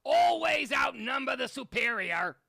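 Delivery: shouting Hitler impression; Hitler rant. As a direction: rant like a dictator at a rally. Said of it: "I can't do him any longer".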